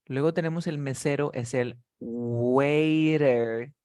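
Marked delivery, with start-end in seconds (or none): 2.0-3.7 waiter stretched very slowly; drawn-out model word. Shown as a demonstration. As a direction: draw out waiter for learners to copy. Teacher models a new word. (waiter alargado, muy lento)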